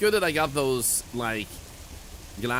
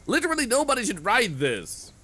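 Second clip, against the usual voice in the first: incredulous; 11 semitones higher, 4 dB louder than usual. Real, not acted. Joke after reading rules.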